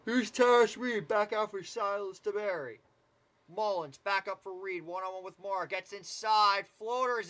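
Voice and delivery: brisk, rising basketball call; live play-by-play. Acted. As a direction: call the game as it unfolds.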